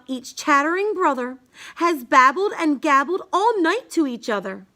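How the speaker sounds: ordinary delivery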